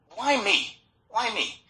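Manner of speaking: high-pitched puzzled plea; acted-out bewildered appeal; plaintive. Acting out his puzzled question.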